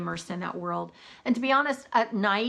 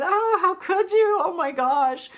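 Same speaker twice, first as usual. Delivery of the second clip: high, loud, drawn-out mock heartbreak; mock dismay; 10 semitones higher, 6 dB louder than usual. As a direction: wail it in playful reproach, higher and louder. Acted tweet of mock heartbreak.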